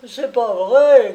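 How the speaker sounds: loud, high, shrieked protest; yelping denial. Acted. The hawker yelps his denial at the policeman, loud and high. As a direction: yelp it out in panic. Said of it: "glapit le camelot".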